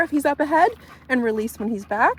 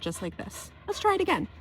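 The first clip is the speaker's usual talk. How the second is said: normal talking voice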